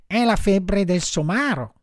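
high-pitched marmot voice; high animal doctor voice; little marmot. A narrator's reading. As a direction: pronounce the diagnosis up high.

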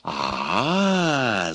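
long drawn-out, sinister "ah"; ominous drawl. A narrator's reading. Baba Yaga realises whom she faces, ominous. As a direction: stretch it out menacingly. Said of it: "зловеще протянула Баба Яга".

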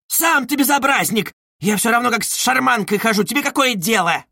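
high-pitched angry comeback; indignant retort. Acted. Widow arguing back at a policeman, indignant.

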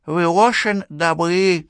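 slow, high, slurred toothless lisp; drunk lisping old woman. Acted. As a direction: lisp it like a tipsy old widow. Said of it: "прошамкала вдова". (пьяная шепелявая старуха)

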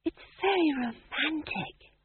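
high, soft, slow dreamy sigh; breathily. A narrator's reading. Magrat swooning over the story, high and breathy. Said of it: "said Maigrat breathily".

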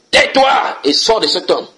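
loud, high, slow command to the demon; commanding rebuke. Acted. Preacher voicing Jesus casting out the demon, loud slow command.

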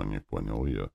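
ordinary delivery, nothing marked

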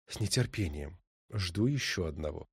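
low, soft knowing answer; quiet. A narrator's reading. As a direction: murmur it fondly. Wife answers, knowing, amused.

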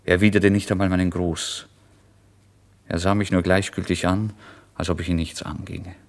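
ordinary delivery

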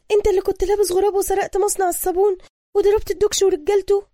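high, loud female character voice; excited woman realizing. A narrator's reading. Woman suddenly recognizing the man before her, excited. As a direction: rattle it out high as she places him. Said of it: "ثم استدركت".

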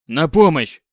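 loud, urgent cry for help; shout for help. Acted. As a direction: yell out for help. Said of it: "крикнул он".

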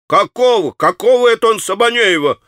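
bewildered man shouting; frantic repetition. A narrator's reading. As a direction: demand it wildly. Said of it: "всё неистово повторял парень".

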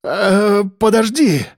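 high, wavering plea; frightened, sheepish interjection; bleating. A narrator's reading. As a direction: bleat timidly to stall him. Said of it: "заблеял Андрей".

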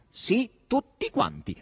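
drawn-out, high emphatic confirmation; slow and high, relishing each word; emphatic answer. A narrator's reading. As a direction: stretch it out, pitched high. Lively answer in a dialogue.

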